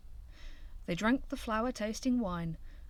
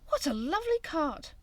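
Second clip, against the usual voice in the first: higher delighted exclaim; delighted cry; 9 semitones higher than usual. A narrator's reading. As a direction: gush over the cart. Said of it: "cried Squirrel".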